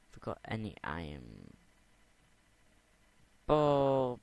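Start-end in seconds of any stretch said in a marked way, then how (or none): 3.5-4.2 loud silly yelp. Real, not acted. Starting a silly chant.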